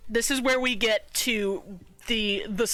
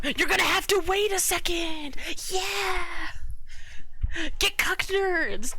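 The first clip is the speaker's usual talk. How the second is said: playful yell, much higher pitch; 9 semitones higher, slower than usual. Real, not acted. Yelling at dog and chat.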